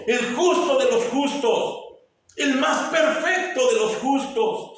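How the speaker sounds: high, loud preaching that builds to a shout; fervent preaching. Acted. Preacher to his congregation about Christ the just, loud fervent preaching. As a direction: preach it up to a cry.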